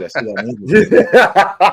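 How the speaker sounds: slow, loud, laughing gossip; nosy neighbor gossip, laughing. Retold or imitated. Mexican dad gossiping about the neighbor, laughing loudly. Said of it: "Hey, man".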